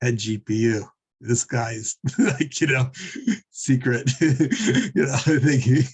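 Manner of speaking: chuckling between broken phrases; words trailing into amused laughter; real giggling. Real, not acted. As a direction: try to talk while cracking up. Teller cracks up at the reversal.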